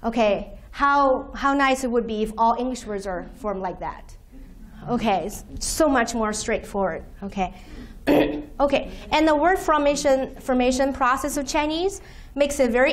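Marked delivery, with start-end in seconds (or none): none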